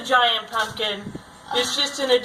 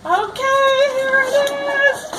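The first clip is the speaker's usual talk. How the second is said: excited; 7 semitones higher than usual. Acted. Presenting the prize.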